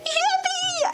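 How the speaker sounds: very high little squeal; playful falsetto celebration; squeaky cheer. Pretend. Cheering new member.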